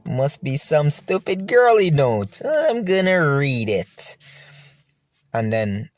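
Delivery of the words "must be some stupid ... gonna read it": slow, raised-pitch musing to oneself; smugly curious, stretching the words; amused smirk. Retold or imitated. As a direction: draw it out through a smile, pleased with the mischief. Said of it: "so I started to smile".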